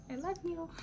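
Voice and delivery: high, soft little 'love you'; affectionate aside. Real, not acted. To the friend who may be watching, affectionate high voice.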